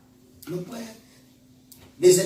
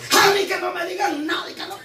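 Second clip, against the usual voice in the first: mock shout; 11 semitones higher than usual. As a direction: bellow it like a proud know-it-all. Congregant bragging about paying debts. (grito burlón y agudo)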